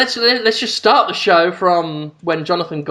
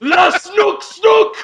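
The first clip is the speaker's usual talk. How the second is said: put-on high, strained French-accented yelling; hammed-up French screaming; screaming mock French; 8 semitones higher, slower than usual. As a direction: shout nonsense French in absurd bursts. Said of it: "Just screaming at the top of his lungs".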